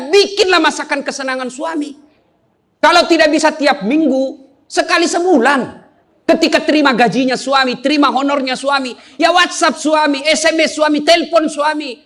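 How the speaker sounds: ordinary talk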